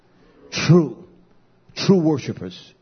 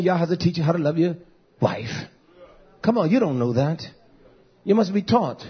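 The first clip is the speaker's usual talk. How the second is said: ordinary talk, nothing marked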